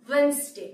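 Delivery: "ওয়েডনেসডে" said WENZ-day; silent d. Shown as a demonstration. Teacher repeating the correction, "ওয়েডনেসডে" as WENZ-day. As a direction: say "ওয়েডনেসডে" without the first d. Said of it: "ডি এর উচ্চারণ হবে না".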